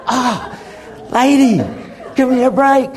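playful exasperated plea. Retold or imitated. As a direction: throw up your hands in mock exasperation. Exasperated retort.

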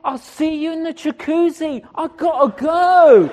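high, loud, building to a shout; urgent escape. Retold or imitated. Preacher fleeing the hot sauna, urgent shout. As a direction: call it out as if desperate to leave.

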